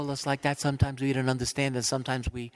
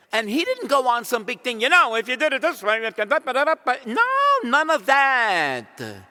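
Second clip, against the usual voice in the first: loud, high pulpit emphasis; booming preacher; full-force preaching; 11 semitones higher, 8 dB louder than usual. Acted. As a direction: hammer the point home.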